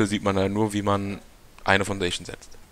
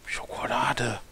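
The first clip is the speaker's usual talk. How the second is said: whispering; 4 semitones higher, slower than usual. Pretend. Teasing whisper offering chocolate.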